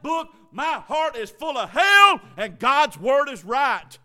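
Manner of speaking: slow, loud preaching climbing to a shout; preaching at full force. Real, not acted. Pastor thundering at the congregation, building to a shout. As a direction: build the sermon into a roaring climax.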